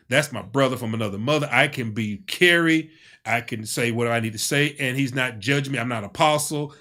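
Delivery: ordinary talk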